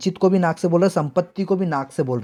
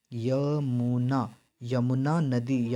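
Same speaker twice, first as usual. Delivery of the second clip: slow dictation; 3 semitones lower, 6 dB quieter, much slower than usual. Shown as a demonstration. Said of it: "रवितनय मतलब होता है यमुना नदी".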